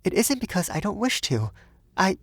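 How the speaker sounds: high, faltering woman's voice; high protest that breaks off hesitantly; earnest young Frenchwoman. A narrator's reading. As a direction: explain earnestly, pitched high.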